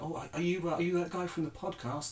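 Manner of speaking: fan voice. Retold or imitated. Imitating a fan approaching.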